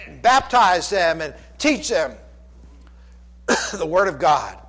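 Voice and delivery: loud, high, driving sermon build; rising revival preaching; full-force preaching. Acted. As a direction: preach it out to the whole church.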